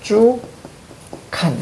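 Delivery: 넙주 칸 in separate syllables with long pauses; broken-up reading demo. Shown as a demonstration. Teacher shows students how to read it, spaced-out demo of 넙주 칸. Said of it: "이렇게 읽어줘야죠".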